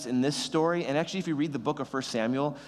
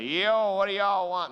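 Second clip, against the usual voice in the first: a hammed-up cowboy, louder and higher; terrible cowboy; 7 semitones higher, 4 dB louder, slower than usual. Jesse answering Samuel at the door, mock cowboy drawl. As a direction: toss it off like a bad Western rancher. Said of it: "That was a terrible cowboy".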